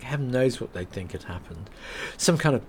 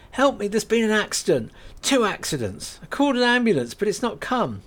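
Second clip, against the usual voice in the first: high, urgent begging for help; breathless plea to strangers; Kate voiced, panicked plea; 11 semitones higher, 5 dB louder than usual. Kate begs the milk float women for help, urgently.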